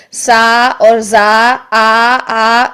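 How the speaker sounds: long, straight open aa in سا and زا; slow سا, زا, then آ held plainly; straight aa vowel. Shown as a demonstration. Teacher showing the sound going straight out, آ long and thin. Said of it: "سیدھی، اسٹریٹ آپ کی جو sound".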